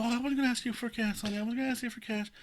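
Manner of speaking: high-pitched put-on woman's voice; lady panhandler. Imitating the lady who approached him, lady panhandler. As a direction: imitate her protesting in a high voice.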